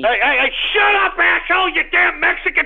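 high, loud furious shouting; enraged yelling. Acted. Host screaming at betraying caller, enraged shout. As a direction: scream at the traitor.

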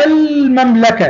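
المملكة with a clear, held l before the m; high-pitched teacher demo, sound the l in المملكة; pronounced lam. Shown as a demonstration. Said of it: "نطقناها وعليها سكون". (نطق «المملكة» بلام ساكنة واضحة)

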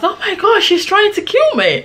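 high, mock-panicked wail; retold crying. Storyteller replaying her plea after the attack, high imitation of crying. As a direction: wail it in a high voice, acting out distress. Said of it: "I started crying".

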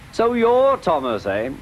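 high-pitched knowing greeting; knowing recognition. A narrator's reading. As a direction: greet a rival you have just recognised. Bertie greets Thomas in story.

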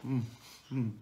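humming while chewing; closed-mouth savoring food; mouth full, chewing. Real, not acted. Seen 0: Forkful eaten at -5; closed-mouth chewing jaw movements while humming.

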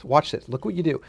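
ordinary delivery

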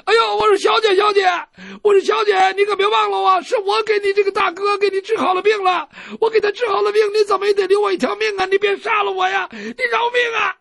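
high, loud pleading for his life; panicked begging. Acted. Captive doctor begging the swordswoman to spare him, high panicked shout. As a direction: shriek it out, terrified and fast. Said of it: "他连吵再嚷".

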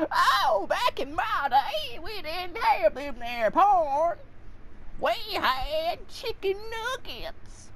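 high, slow, drawn-out granny voice; old grandma. Pretend. Old grandma recalling her day, high slow voice. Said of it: "You sound like an old grandma".

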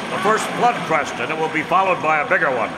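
high, loud commanding shouts; urgent shouting. Acted. Masked man ordering the town to evacuate, loud urgent shouts. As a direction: drive the crowd to move now. Urging evacuation as wave hits.

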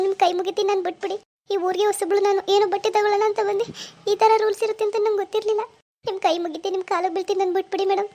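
high-pitched, crying and begging; tearful pleading. Acted. New girl in town begging the shopkeeper, tearful pleading. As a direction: beg through frightened tears. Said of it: "ನೋಡಿ, ಅಳ್ಬೇಡಿ".

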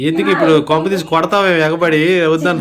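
very loud, full-voiced protest; shouting. Real, not acted. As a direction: shout back at being hushed. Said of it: "ఎందుకు అరుస్తున్నావు?".

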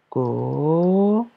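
"Gauche" with a very long "gau", a gap, then "che"; drawn-out "gau... che"; syllable by syllable. Shown as a demonstration. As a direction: stretch and split "Gauche" for dictation. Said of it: "première syllabe".

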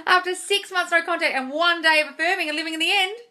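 amazed repeat. Real, not acted. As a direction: say it again in disbelief.

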